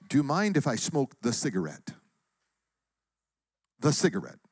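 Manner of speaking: put-on exotic Syrian accent; Syrian woman's accent. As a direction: imitate an elegant Syrian woman asking leave. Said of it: "I gotta get in right voice here".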